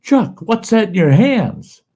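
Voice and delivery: high, slow, loud mock yell; retold yell. Teasing Chuck about the snake in his hands, high slow yell. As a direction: call it out slowly, high and loud. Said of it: "And I yell at him".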